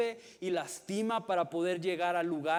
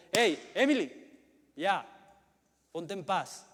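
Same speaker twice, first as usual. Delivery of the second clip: sharp, loud, higher call to stop; stern scolding; 3 semitones higher, 7 dB louder than usual. Shown as a demonstration. Father scolding his little daughter to settle down, loud and stern. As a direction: snap her name out like a firm dad. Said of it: "aunque mi voz suena así".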